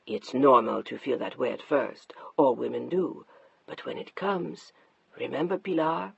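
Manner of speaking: lightly voiced husband explaining women; condescending husband. Mark soothing his wife, low and condescending.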